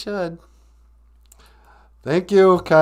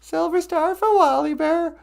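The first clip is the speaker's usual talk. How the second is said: squeaky high voice; 14 semitones higher than usual. Pretend. Repeats line in squeaky voice.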